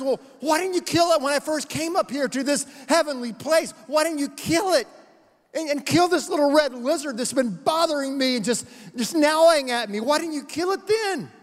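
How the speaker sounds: a ghost demanding why the angel waited; protesting ghost. Acted.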